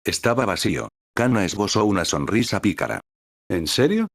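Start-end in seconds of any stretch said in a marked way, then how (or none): 3.5-4.1 higher, mischievous en serio; teasing. A narrator's reading. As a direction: needle her playfully. Kana teasing with a mischievous smile.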